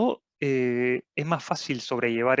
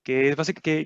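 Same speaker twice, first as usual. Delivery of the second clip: ordinary talk, nothing marked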